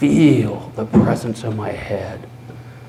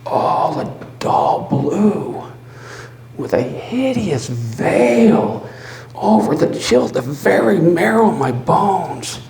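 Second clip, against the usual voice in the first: higher, louder, seething; rising fury; 8 semitones higher, 5 dB louder than usual. Acted. Narrator raging at the hideous eye. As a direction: let the loathing build.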